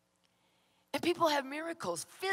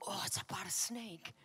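whispered realisation; 5 semitones lower, 9 dB quieter than usual. Retold or imitated. Sudden realisation at night.

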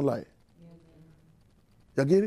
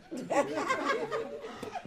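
high, light laughter; high-pitched chuckling; 11 dB quieter than usual. Real, not acted. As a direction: laugh at your own joke. Laughs at own joke about Andy.

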